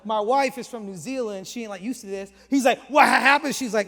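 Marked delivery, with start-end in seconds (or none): 2.9-3.5 loud, high retold challenge; aggressive. Angry train rider yelling at the couple, aggressive. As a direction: shout it as the imitated bully. Stranger shouting as wife backs off.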